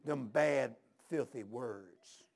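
low, slow, softly spoken reproach; disapproving old preacher. Retold or imitated. Old preacher scolding the visitor over language, low disapproval. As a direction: drop low and spell out the disgust slowly.

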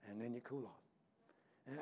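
low, soft and slow, fading away. Shown as a demonstration. Preacher mimics believers cooling off, voice fading low. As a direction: let the words die out like embers. Voice drops for cool off.

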